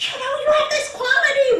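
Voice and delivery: imitating a scornful remark; harsh put-down. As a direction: sneer it like a cutting critic. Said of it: "You don't have to say it like that".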